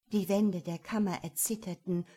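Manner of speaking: ordinary delivery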